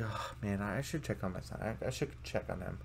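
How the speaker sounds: soft, worried muttering; worried murmur. Retold or imitated. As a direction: murmur with concern. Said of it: "And it's like".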